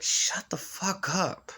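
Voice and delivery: annoyed. Retold or imitated. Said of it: "I'm just like".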